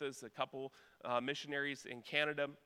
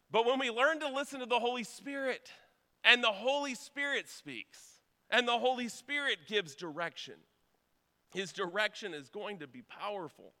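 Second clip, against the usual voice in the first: raised, louder preaching voice; 6 semitones higher, 4 dB louder than usual. Acted. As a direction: preach it out with fervour. Preaching at full force.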